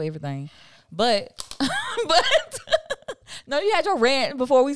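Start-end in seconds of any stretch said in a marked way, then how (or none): 1.4-2.0 high burst of laughter. Real, not acted. Laughs at her own rant. 2.6-3.4 high laugh. Real, not acted. Laughs at her own rant.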